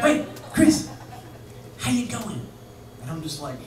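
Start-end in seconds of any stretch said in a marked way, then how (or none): 0.0-2.5 high, chummy British greeting. Retold or imitated. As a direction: sing out the mate like a London rocker. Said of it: "But he's like this".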